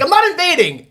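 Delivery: high mock protest; lightly voiced indignant leader; defensive leader. Mock Russian leader denying the invasion, defensively. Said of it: "He's like".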